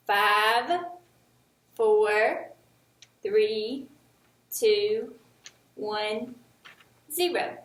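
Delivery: slow countdown, a pause after each number; counting backwards. Shown as a demonstration. Teacher leading the class through a countdown. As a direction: count down one number at a time, leaving space. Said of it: "So let's count".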